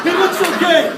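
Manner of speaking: high, loud exaggerated reassurance; playful over-the-top parent; mock consoling. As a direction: reassure at full volume in a hammed-up high voice.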